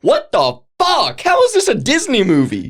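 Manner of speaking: high-pitched laughing disbelief; incredulous outburst. Real, not acted. Host baffled that Disney made this dark movie, incredulous. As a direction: exclaim it, voice leaping up in bafflement.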